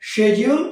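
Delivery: শিডিউল said "she-jul"; শিডিউল starting "shej"; British pronunciation. Shown as a demonstration. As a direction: say শিডিউল with e and a j sound. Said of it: "British প্রনাউন্সিয়েশনকে".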